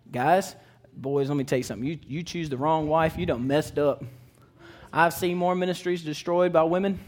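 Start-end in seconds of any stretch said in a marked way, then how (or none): none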